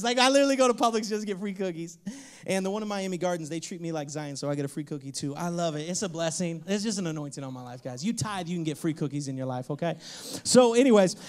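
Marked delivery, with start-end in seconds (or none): none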